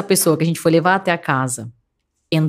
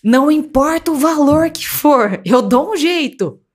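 nervous father; 7 semitones higher, slower than usual. Retold or imitated. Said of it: "meu pai nervoso".

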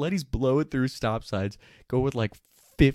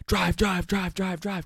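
urgent high yell to go; panicked shouting at the driver; yelling drive; 8 semitones higher, slower than usual. Retold or imitated. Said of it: "screaming at Beef".

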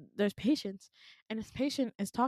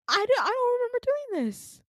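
high, loud stammered disbelief; shocked; 14 semitones higher, 4 dB louder than usual. Retold or imitated. Watching own footage of the outburst, mock shocked. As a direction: re-enact being stunned, breaking into a laugh. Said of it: "I'm staring at it shocked".

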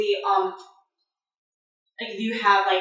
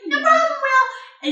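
very high, drawn-out call; squeaky, slow reassurance in passing; cheery kobold reply; 6 dB louder, slower than usual. Acted. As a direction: sing it out high and loud. Jenny the kobold answers.

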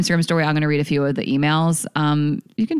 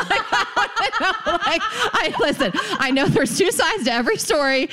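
laughing; 11 semitones higher than usual. Real, not acted. Laughing through disbelief.

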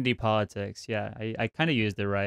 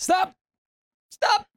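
high shrill yell of stop; shouting; 4 dB louder than usual. Acted. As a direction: yell it at the top of your voice.

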